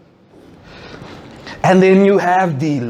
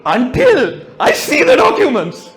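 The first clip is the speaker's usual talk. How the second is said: high mock-dramatic protest voice; hunger-striking bloke; 9 semitones higher than usual. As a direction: play a poor bloke vowing a hunger strike, pitched way up. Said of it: "he's like".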